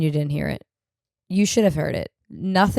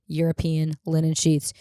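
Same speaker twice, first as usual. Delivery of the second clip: usual speaking voice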